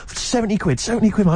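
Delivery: imitating a seller yelling his price; a touch of a street-seller shout; mock shouting. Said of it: "he was just shouting at me".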